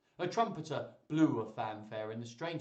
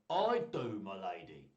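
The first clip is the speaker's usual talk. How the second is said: lightly acted young stranger; stranger character; 5 semitones lower than usual. Trying out the stranger's voice.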